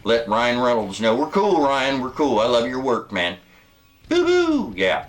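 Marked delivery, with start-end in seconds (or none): 4.1-4.7 high, playful "boo-boo" whoop; high whooped hoot. Acted. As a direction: hoot it out, much higher than speech. Mock cheer after praising the actor.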